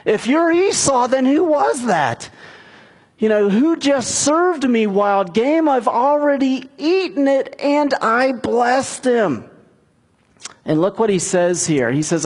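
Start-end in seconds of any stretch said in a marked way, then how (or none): 0.0-9.5 high-pitched, stunned disbelief; retold alarm, climbing high; acted shock. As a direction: act out shocked, bewildered questioning, pitch raised. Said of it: "Isaac began to tremble uncontrollably".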